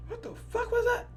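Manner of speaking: very high, thin-volume squeak of bewilderment; stunned disbelief. Retold or imitated. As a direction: squeak it out, acting dumbfounded. Acts out stunned sitting back.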